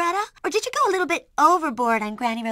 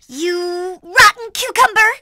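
slow, loud, higher-pitched insult; shouted insult; 4 semitones higher, 6 dB louder, slower than usual. Acted. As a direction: shout it out, drawing out each word. First try at shouting an insult.

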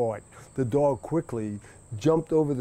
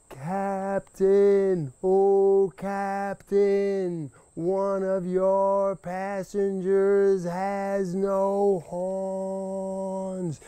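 high, slow, mighty call across the water; 6 semitones higher, much slower than usual. Acted. Rabbit calling from the last hill to the captain, mighty and slow. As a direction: throw it out with all his might. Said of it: "called out in a mighty voice".

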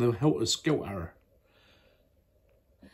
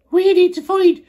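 high, loud put-on boy's voice; changed accent for Joe; 8 dB louder than usual. Parent voicing Joe in a bedtime story, high silly accent. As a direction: switch to a new silly accent. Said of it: "who's just changed his accent".

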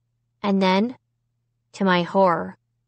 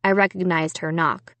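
ordinary delivery

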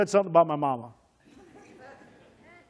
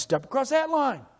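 high, louder mocking taunt; hammed-up bully daring a kid; 9 semitones higher, 4 dB louder than usual. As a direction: taunt him in a high sneering voice. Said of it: "He says".

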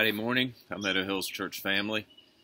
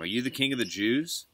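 higher-pitched question with a rising end; raised, rising formal question; 6 semitones higher, 7 dB louder than usual. A narrator's reading. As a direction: ask it pointedly, lifting at the end. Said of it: "Pilate asked him".

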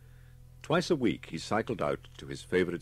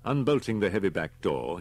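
plain reading voice

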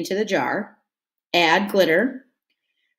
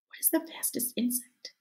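softly whispered question, much softer; 14 dB quieter than usual. Shown as a demonstration. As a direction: whisper it like a secret.

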